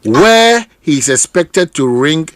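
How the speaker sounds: slow, deliberate reading of a statement; measured official read-out; reading aloud. Shown as a demonstration. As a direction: read the release out word by word. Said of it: "reads the news release aloud".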